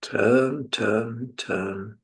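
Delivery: ordinary delivery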